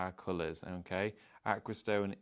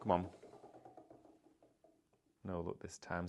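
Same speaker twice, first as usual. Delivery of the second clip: ordinary talk, nothing marked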